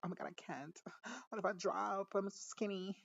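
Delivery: put-on very high, softened girly whine; hammed-up fretting in a high voice; mock helpless girl. Acts out girl fretting about skydiving.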